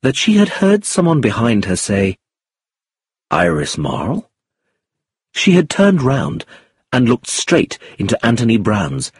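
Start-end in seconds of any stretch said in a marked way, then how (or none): 3.3-4.3 low questioning lift on Marl. A narrator's reading. Man greeting an acquaintance at a dance, questioning. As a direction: ask it, low, with a rise on Marl. Someone checks it is her.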